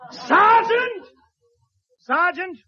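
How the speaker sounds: high loud shout for the sergeant; calling out. Acted. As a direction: bellow his name.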